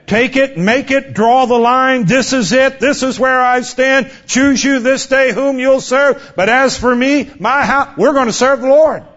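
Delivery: high, ringing preacher's cry; rising pulpit fervor; fiery preaching. Acted. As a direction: call the church to take a stand. Preaching at full force.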